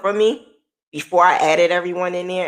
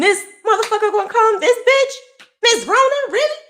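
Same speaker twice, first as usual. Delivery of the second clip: high-pitched indignant wail; tearful outrage; 5 dB louder than usual. Real, not acted. Survivor of so much rails at Miss Rona, high and outraged. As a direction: squeal it in disbelief, louder and near crying.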